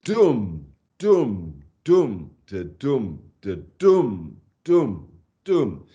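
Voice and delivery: slow, separate, falling "dum" with light "da"; long heavy "dum" beats at front and back; powerful stresses. Shown as a demonstration. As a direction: boom out the line's rhythm, leaning on every "dum". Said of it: "These very powerful stresses".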